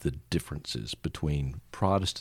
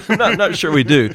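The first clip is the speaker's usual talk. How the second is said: laughing, louder and higher; laughing along; 10 semitones higher, 8 dB louder than usual. Real, not acted. Host laughing along with his guest, real laughter. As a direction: agree through a real laugh.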